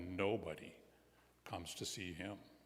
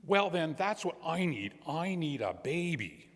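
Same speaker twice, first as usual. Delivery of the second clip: high, eager declaration; truck driver; 8 semitones higher than usual. A narrator's reading. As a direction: pitch jumps up as he decides. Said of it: "And the man says".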